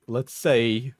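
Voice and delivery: ordinary talk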